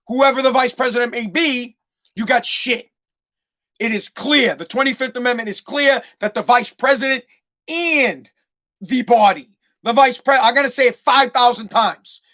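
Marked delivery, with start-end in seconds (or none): none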